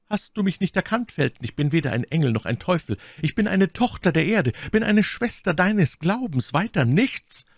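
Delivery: high, impassioned lady; My Lady, beschwörend. A narrator's reading. (hohe, leidenschaftlich beschwörende Frauenstimme)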